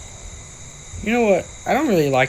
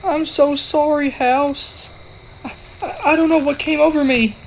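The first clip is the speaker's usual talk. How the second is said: high, slow, shrieking cartoon panic; much higher panicked voice crying out then babbling sorry; panicked squeal; 10 dB louder, slower than usual. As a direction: squeal like a terrified little character. Panicked character flees exploding house.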